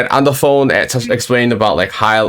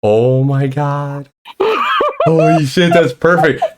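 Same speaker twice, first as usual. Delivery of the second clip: amused disbelief; 4 semitones higher, slower than usual. Retold or imitated. Retelling own reaction to the typo.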